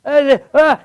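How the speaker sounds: high, loud mock live report; overselling storm reporter. Reporter on the beach in a storm, oversold shouting and grunts. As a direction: shout over a pretend gale, hamming it up. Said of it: "he's just overselling it".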